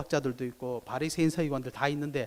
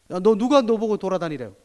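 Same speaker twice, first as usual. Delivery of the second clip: higher, louder accusing question; mock scolding; 5 semitones higher, 6 dB louder than usual. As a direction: interrogate him sharply. (추궁하는 흉내)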